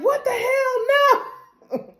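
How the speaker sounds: high-pitched mock outrage, laughing; hammed-up shocked what the hell; high outraged yelp. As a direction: shriek it in disbelief. Mayor outraged, high and loud.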